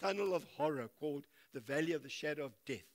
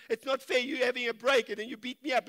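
mock indignant devil; 6 semitones higher, 7 dB louder than usual. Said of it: "he gets mad because he says".

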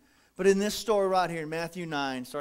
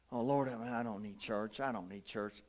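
low, much softer mumbled refusal; dismissive low mutter; stubborn refusal; 10 semitones lower, 10 dB quieter than usual. Retold or imitated. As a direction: brush off the invitation under your breath. Retold stubborn younger self.

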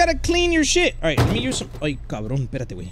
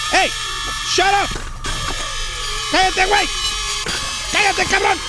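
high, raised-voice scolding; raised pitch, fed up; exasperated yelling; 6 semitones higher, faster than usual. Real, not acted. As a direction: yell at a screaming patient to shut up. Yelling at a screaming game patient.

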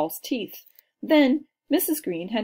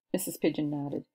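ordinary delivery, nothing marked

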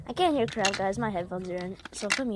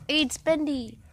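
usual speaking voice

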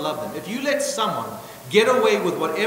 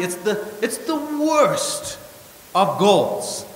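raised, higher voice, hammering each point home; preaching at full force; 5 semitones higher than usual. Acted. Pastor to his church, preaching hard against the goal of happiness.

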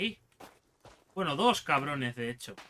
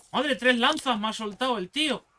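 louder, higher outraged cry; indignant exclaim; 5 semitones higher, 4 dB louder than usual. Real, not acted. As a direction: shout it in disbelief. Hit by spears.

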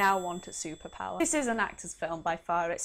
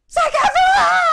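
a screaming fangirl, much higher and louder; 18 dB louder than usual. Pretend. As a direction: shriek the name like a starstruck fan. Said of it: "review this like a chick".

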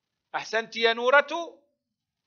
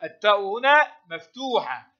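normal talking voice

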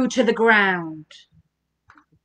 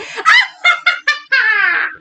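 high, falling witch cackle; witch's laugh; 9 semitones higher than usual. A narrator's reading. Witch laughing aloud in the storm, long high cackle. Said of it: "do the witch's laugh".

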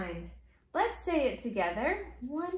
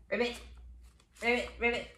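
low, short ribbits; little frog calls, one then two; 5 semitones lower, 5 dB quieter, much faster than usual. Acted. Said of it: "Like a frog".

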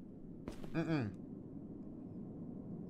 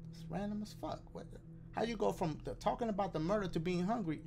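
ordinary talk

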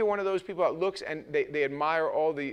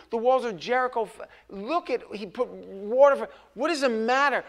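mock exasperated rebuke; 6 semitones higher, 6 dB louder than usual. Pastor voicing God's frustrated rebuke.

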